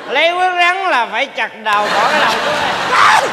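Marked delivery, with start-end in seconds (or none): 2.0-3.3 shouting order. Acted. Demanding the snake's head.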